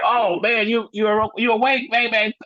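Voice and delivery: high, stunned voice of the friend; amazed friend. Retold or imitated. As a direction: play the coworker in disbelief. Voicing the amazed coworker.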